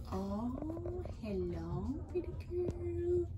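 quiet, slow coo to a hen; tender, drawn-out pet talk; soft cooing. Real, not acted. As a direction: greet her gently, barely above a murmur.

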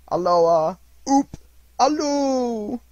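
high, loud hallooing call. A narrator's reading. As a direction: hail someone running far off. Scrooge calls after Friday.

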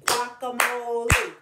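GUACAMOLE loud, beaten out syllable by syllable; clapped syllables. Shown as a demonstration. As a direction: punch each syllable of Guacamole. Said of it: "let's clap those words together".

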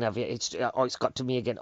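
ordinary talk, nothing marked

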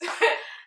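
breathy laugh. Real, not acted. Laughs at own mispronunciation.

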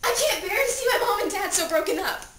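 loud, high, distraught cry; desperate plea. Acted. Dead teen at own funeral begging to wake, desperate. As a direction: beg frantically to be rescued.

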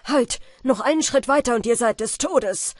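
loud commanding shout. A narrator's reading. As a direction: bellow the order at them. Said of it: "schrie der Jäger mit starker Stimme".

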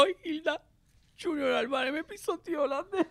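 high, whiny offended complaint; hammed-up offended whine; offended diva. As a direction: act the wounded diva tattling to the boss. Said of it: "la loca ofendida".

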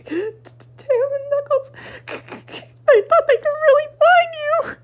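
high, wailing sob-talk; comic wailing. Acted. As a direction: wail it like a hammy cry.

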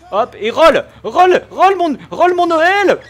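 loud high chant, urging on; excited shout. Real, not acted. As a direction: egg him on, loud and high. Urging teammate on pick and roll. (encouragement crié et aigu)

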